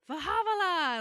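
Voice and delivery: high, loud mock yell of Fahavala; hammed-up yell; imitating a yell. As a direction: shout Fahavala like clearing the way. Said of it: "That's what Jean always yells".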